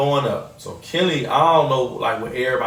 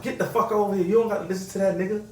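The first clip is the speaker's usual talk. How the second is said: higher mock yelling mom; imitating Trina; 7 semitones higher, faster than usual. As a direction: bark it at the kid, half-hammed. Trina yelling again, voiced.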